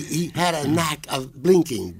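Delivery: usual speaking voice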